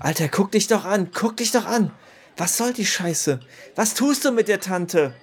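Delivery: hoch, laut und verächtlich; höhnisch wütend. Acted. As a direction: ihn verächtlich anfahren. Höhnisches Anfahren im Streit. (hoch und laut, verächtlich höhnend)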